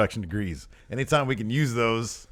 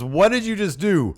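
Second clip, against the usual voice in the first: loud, high mock-outraged question; raised-voice imitation of a stunned reporter; shocked interviewer; 10 semitones higher, 7 dB louder than usual. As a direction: demand it like a scandalized ringside interviewer. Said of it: "Corey says".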